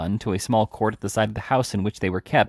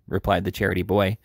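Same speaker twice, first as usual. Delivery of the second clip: ordinary delivery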